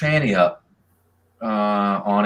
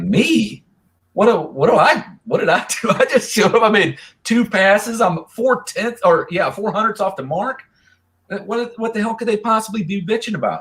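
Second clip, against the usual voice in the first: incredulous retold protest; 9 semitones higher than usual. Retelling his baffled reply.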